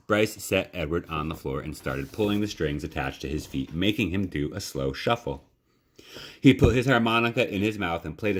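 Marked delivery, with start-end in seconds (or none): none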